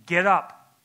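loud emphatic Get up; exclaimed command. Retold or imitated. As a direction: say it with an exclamation point. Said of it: "exclamation point".

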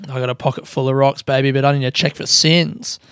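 normal talking voice

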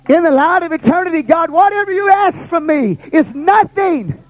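preaching at full force. Acted. Pastor urging the congregation off Egypt, preaching at full force. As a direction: build the fire, preach it hard to the church.

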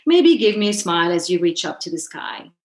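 ordinary delivery, nothing marked

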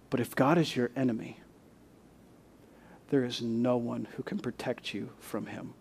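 ordinary talk, nothing marked

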